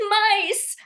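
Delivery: high, loud panicked shriek; hysterical cry. Retold or imitated. Calling her mom after finding dead mice, panicked high shriek. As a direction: yelp it out in terror. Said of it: "I was hysterical".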